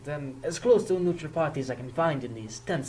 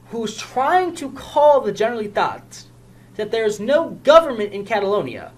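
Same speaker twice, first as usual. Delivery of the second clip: higher and louder, pressing the charge; heated accusation; 7 semitones higher, 6 dB louder than usual. Acted. Officer confronting union man, louder and higher with heated accusation. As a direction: lay out each charge, rising in anger.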